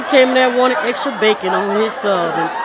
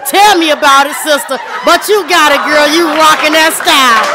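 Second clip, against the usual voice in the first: sassy hype; 5 semitones higher, 7 dB louder than usual. Acted. Hyping women in crowd.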